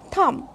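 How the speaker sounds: "thumb" with the final B left silent. Shown as a demonstration. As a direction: say "thumb" ending on M, no B. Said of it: "b is silent".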